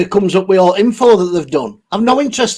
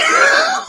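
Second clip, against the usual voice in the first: sudden squeaky laugh; high burst of laughter. Real, not acted. Vlogger cracking up at a viewer's joke, squeaky laugh.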